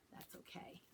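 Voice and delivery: soft whispered reassurance. A narrator's reading. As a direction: whisper it gently.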